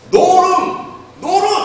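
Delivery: put-on high, loud clumsy child shout; childish innkeeper. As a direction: bark out the line like a slow boy. Ralph's two-word innkeeper line. (높고 크게 외치는 서툰 아이 목소리)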